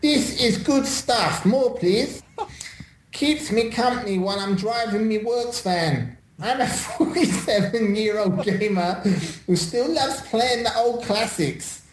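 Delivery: high-pitched street-slang accent, hammed up; comic high-voiced street-slang accent; put-on street-slang accent. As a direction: read it in a jokey "innit, mate" accent. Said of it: "Do it in your what's-it-called accent".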